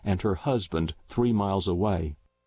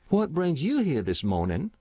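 put-on friendly old Matilda; 7 semitones higher than usual. A narrator's reading. Matilda greets Reef.

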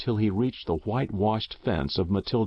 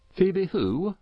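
higher, questioning neighbour voice; a baffled farmer asks who he means; puzzled Farmer Dodge; 10 semitones higher than usual. A narrator's reading. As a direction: Dodge not placing the name. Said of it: "inquired Farmer Dodge".

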